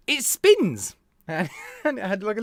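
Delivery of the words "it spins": high, loud "It spins!"; excited exclamation. Retold or imitated. Seller proudly showing his vape, excited. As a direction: blurt it out proudly. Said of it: "he just went".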